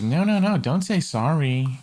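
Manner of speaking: mock reassurance. Acted quote, mocking reassurance.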